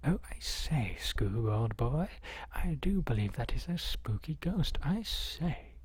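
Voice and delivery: lilting, hammed-up cartoon sleuth voice; cartoon impression. Streamer telling Scoob about a spooky ghost, hammed-up cartoon voice. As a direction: swing the pitch up on the last words. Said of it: "And Scooby-Doo goes".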